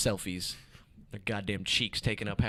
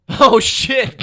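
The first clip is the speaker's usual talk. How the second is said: high, very loud exclamation; loud burst of surprise; shocked outburst; 14 semitones higher, 15 dB louder than usual. Real, not acted. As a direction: shout it in amused shock. Reacts to Joey Diaz reveal.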